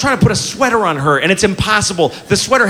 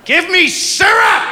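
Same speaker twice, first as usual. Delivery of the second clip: put-on slow, high-pitched angry child's demand; hammed-up tantrum, each word dragged out; furious three-year-old; 12 semitones higher, slower than usual. Angry toddler demanding breakfast from dad, furious. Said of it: "she's a little ball of anger".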